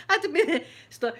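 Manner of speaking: ordinary delivery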